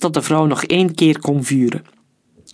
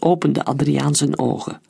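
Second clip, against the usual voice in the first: usual speaking voice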